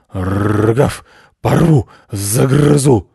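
loud growling dog threats with rolled R; growly, loud bursts; frantic dog barking. A narrator's reading. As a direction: bark the words like a furious guard dog. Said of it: "истошно гавкая".

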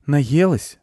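put-on high boy's question; high-pitched young boy asking gently; little boy. A narrator's reading. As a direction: ask in a high child voice. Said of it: "спросил мальчик".

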